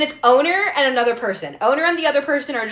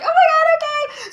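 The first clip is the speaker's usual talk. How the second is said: high-pitched, flustered squeal; flustered giving in. Retold or imitated. Woman giving in to pushy friends, flustered and high. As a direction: give in with a very high mock-exasperated cry.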